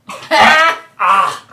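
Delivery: loud laugh that chokes off in a cry. Acted. Game master voicing the monster choking on the acid. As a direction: laugh and then gag suddenly as acid hits. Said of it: "he starts to laugh".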